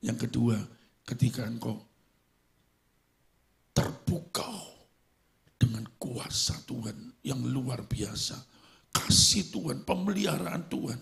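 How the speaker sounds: usual speaking voice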